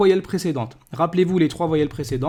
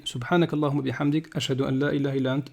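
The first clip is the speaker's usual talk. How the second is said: fast, soft murmured dua; closing prayer; 3 semitones lower, 5 dB quieter, faster than usual. Real, not acted. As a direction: rattle off the closing supplication.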